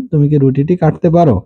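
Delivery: normal talking voice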